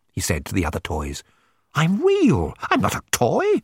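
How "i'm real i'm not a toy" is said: high-pitched little dog protesting; indignant toy dog. A narrator's reading. Rover complains to the other toys, indignant. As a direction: protest in a raised small-dog voice.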